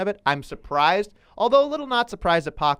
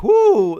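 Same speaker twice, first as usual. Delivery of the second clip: loud, high whoop; imitated "whoo"; 9 semitones higher, 9 dB louder than usual. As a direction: let out a sudden high whoo. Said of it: "That was like".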